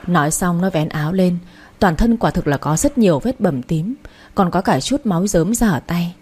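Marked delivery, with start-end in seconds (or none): none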